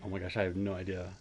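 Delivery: normal talking voice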